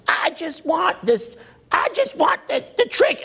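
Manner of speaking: high, whiny mock newbie voice. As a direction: mimic a needy beginner begging for the trick. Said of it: "newbies that are like".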